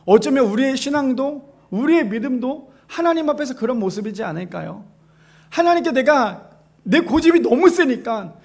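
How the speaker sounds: usual speaking voice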